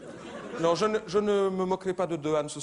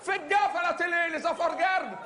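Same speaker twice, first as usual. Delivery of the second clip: mock warning; 11 semitones higher than usual. Comedian warns the audience in a high mock shout. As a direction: shout it like a public alarm.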